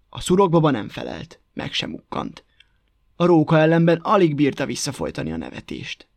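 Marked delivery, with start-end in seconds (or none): none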